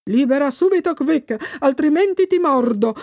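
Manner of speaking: a mock roar of a fierce pike; thundering pike. A narrator's reading. Pike threatening Thomas with a mock thunderous roar. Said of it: "tuonò il luccio".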